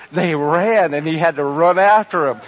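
ordinary talk, nothing marked

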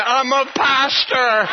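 high, drawn-out confession; slow it down and pitch it up for the laugh; gleeful punchline. Retold or imitated. Pastor confessing his job to the officer, gleefully drawn out.

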